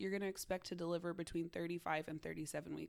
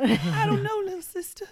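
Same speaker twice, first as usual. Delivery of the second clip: high, loud mock Southern baby; 12 semitones higher, 13 dB louder than usual. Twin answering sister in high Southern baby voice. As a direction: whine back like a Southern toddler. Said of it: "I don't know why they turned Southern".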